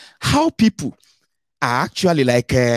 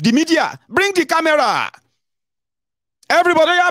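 high excited call to the press. Pretend. Winning candidate calling media over, excited high call. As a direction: summon the cameras like a celebrity.

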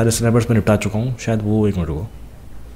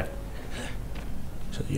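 breathy whisper while sneaking; hushed whisper behind the enemies; 18 dB quieter, much slower than usual. Real, not acted. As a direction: barely voice it, staying hidden.

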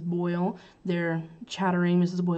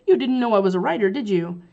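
fast and louder, a touch pointed; quick arch reply; 3 semitones higher, 7 dB louder than usual. Acted. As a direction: toss it back quickly and sharply. Reads Casewell's line.

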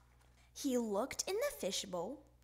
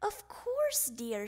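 yawning; 8 semitones higher, slower than usual. Acted. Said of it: "she yawned".

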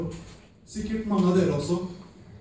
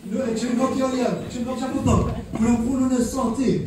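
usual speaking voice

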